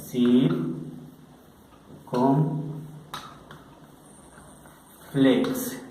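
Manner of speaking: circunflex dragged out syllable by syllable; very slow. Shown as a demonstration. Teacher models the word very slowly. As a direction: stretch circunflex as long as you can. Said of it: "Acento circunflex".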